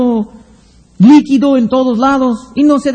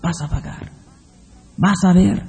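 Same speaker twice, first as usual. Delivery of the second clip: low and much softer, a deep murmured warning; 5 semitones lower, 9 dB quieter than usual. Acted. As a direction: speak it as a hushed inner warning. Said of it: "con una voz muy baja está diciendo".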